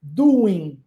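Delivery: doing said as doin, no final G; doing ending on N; silent G. Shown as a demonstration. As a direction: end doing on the N, drop the G. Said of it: "esse G não é pronunciado".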